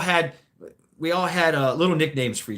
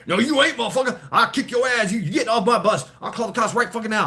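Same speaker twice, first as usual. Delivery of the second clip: imitating the driver yelling, higher, louder and fast; angry bus driver; 9 semitones higher, 3 dB louder, faster than usual. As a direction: bark it like the furious old driver. Driver quarrel continues.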